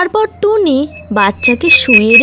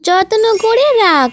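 elder bird voice; 6 semitones higher than usual. Acted. Elder bird scolds Tuni.

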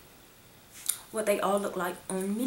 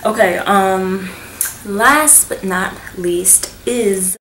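drawn-out teaser; slow, loud build-up; 8 dB louder, slower than usual. Shown as a demonstration. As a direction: stretch it out before the reveal.

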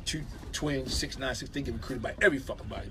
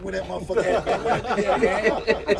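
raised, laughing imitation of the other players; loud laughing quote; 8 semitones higher, 10 dB louder than usual. Players asking for the missing guard, loud and laughing. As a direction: call out where he was, half laughing.

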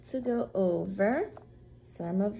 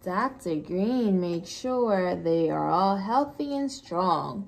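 usual speaking voice

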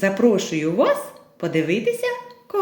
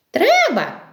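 little mice; 11 semitones higher than usual. Acted. Mice answer rooster in tale.

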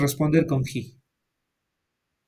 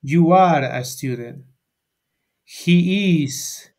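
slow, careful classroom model sentences; much slower than usual. Shown as a demonstration. Teacher modelling sentences for the class, slow and deliberate. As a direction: spell out each sentence slowly for Spanish-speaking students. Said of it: "en las oraciones digo".

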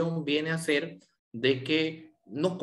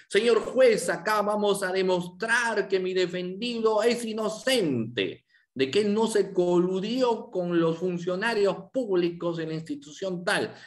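higher and louder, almost shouting at the start; raised courtroom voice; 6 semitones higher, 3 dB louder than usual. Shown as a demonstration. Lawyer addressing the judge at trial opening, near-shouting raised voice. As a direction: project to the whole courtroom like a lawyer opening a case. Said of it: "empezar a elevar la voz".

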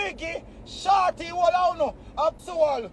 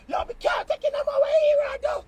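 much higher, fast, mocking his rival's voice; mock whining rival; 11 semitones higher, faster than usual. Mocking the rival who can't take it, high fast whine. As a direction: squeal it like the beaten man giving up. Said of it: "I say".

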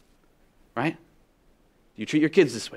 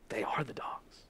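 breathy whisper of a secret; hushed confession; 3 semitones lower, 16 dB quieter, slower than usual. Acted. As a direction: lean in and whisper it. Revelation whispered to the congregation.

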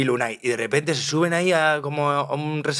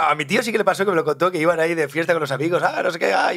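ordinary talk

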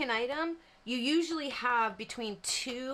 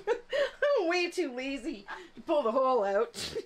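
giggling through the words; amused at yourself, voice lifting with laughter; laughing confession; 5 semitones higher than usual. Real, not acted. Crafter admitting to class she is lazy, laughing. Laughing at her own laziness.

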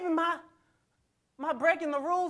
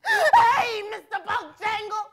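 mocking taunt; 8 semitones higher, slower than usual. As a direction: throw the taunt out sharp and high, then let it drop.

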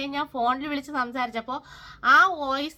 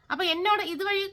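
ordinary talk

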